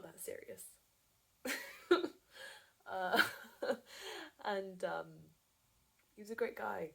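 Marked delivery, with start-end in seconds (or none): 2.9-4.4 high, loud burst of nervous laughter; high-pitched uneasy laughing; awkward laugh. Acted. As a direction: laugh awkwardly over an uncomfortable confession. Awkward laugh about broken engagement.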